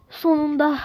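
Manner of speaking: loud, high gasp then cry of relief; finally made it, cry out; relieved joy. Real, not acted. Finally clears the level.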